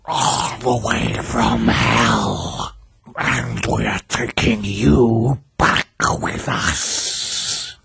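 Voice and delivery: slow, menacing spectral hiss; strange hissing voice. A narrator's reading. Dead victims from hell threatening their killer, hissing. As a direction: hiss a drawn-out threat from beyond. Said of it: "hissing voice from Charles".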